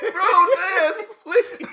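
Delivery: high, loud, laughing mock plea; pleading through laughter. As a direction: beg them to dance while cracking up. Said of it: "It sounded like he was pleading".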